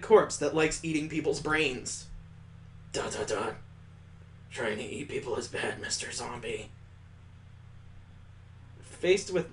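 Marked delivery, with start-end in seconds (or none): 2.9-6.7 put-on low, soft deadpan child scolding; soft blank child. Small girl deadpan scolding the zombie softly. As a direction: scold him gently, flat as a small child.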